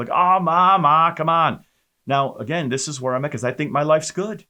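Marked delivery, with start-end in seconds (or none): 0.1-1.6 loud, high, exasperated brush-off; exasperated whine. Retold or imitated. Son brushing off his mother's newfound faith, loud exasperated whine. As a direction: wave off his mother loudly, half mocking.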